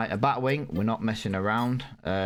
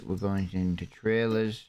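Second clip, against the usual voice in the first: usual speaking voice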